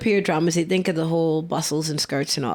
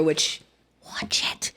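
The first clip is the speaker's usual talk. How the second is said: breathy whispered aside; 3 semitones lower, 6 dB quieter, slower than usual. Real, not acted. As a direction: drop to a whisper. Whispered aside recommending the movie.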